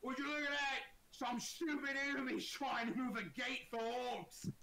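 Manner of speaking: high-pitched, loud orc jeer; loud mocking orc. Orc mocking someone trying to move a gate, high and loud. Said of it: "hear an orc laugh very loudly and go".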